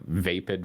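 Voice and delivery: "vapid" said as VAY-pid; "vapid" rhyming its first syllable with day; vape-like first syllable. Real, not acted. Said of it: "bringing over the vape pronunciation".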